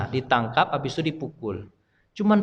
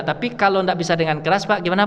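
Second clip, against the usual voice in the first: normal talking voice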